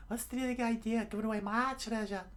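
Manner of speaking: very high squeaky indignation; high-voiced telling-off; mock anger. A narrator's reading. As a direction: scold in a cartoon falsetto. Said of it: "with pure anger".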